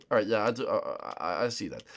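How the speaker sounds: usual speaking voice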